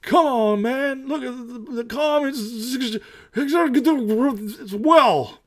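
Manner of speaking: mock old politician bragging about the economy; mock Joe Biden. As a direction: do a hammed-up Joe Biden brushing it off. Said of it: "so Joe Biden can go out there and say".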